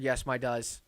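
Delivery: usual speaking voice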